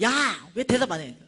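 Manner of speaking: loud mock accusation; imitated indignant demand. Homeless woman grabbing the preacher, demanding loudly. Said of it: "내 뒤 목을 뜩 잡더니".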